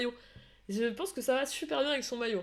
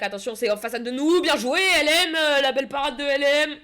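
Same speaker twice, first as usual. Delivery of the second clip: louder, higher burst at the save; excited shout; 4 semitones higher, 9 dB louder than usual. Real, not acted. Calling the keeper's save live for the chat, excited shout. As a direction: yell the warning, then cheer the save. Sudden danger then a save.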